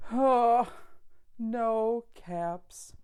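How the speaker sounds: usual speaking voice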